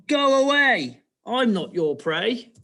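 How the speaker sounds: high, loud piggy shooing; acted little pig sending someone off; piggy's reply. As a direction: cry out high, then ease down. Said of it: "And then she carries on".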